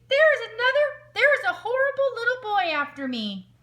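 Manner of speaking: high, loud fake panic; yelling for help. A narrator's reading. Wolf tricking the shopkeepers again, loud fake panic. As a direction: holler it over the pond. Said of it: "yelled as loud as he could".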